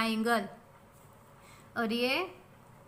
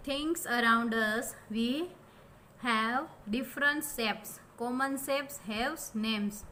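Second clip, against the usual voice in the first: 1 slow dictation read; much slower than usual. Shown as a demonstration. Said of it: "teacher reads textbook slowly".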